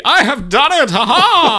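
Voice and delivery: high, loud cartoon villain gloat. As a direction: cackle it like a mustache-twirling villain. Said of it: "he twirls his mustache".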